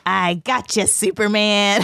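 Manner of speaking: lightly voiced gloating villain; a hint of a cartoon villain; evil villain voice. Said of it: "give us an evil villain voice".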